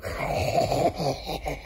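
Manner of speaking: breathy, voiceless villain chuckle; terrible put-on laugh. Schemer giving away the trick, terrible fake laugh. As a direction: laugh badly, as if hiding a scheme. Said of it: "That was a terrible laugh".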